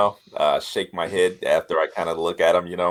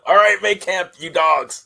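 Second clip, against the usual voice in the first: raised, high-pitched command to the men; barked order; 9 semitones higher, 4 dB louder than usual. Acted. Passing the colonel's order to the men, barked command. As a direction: bark it out like a rough camp boss.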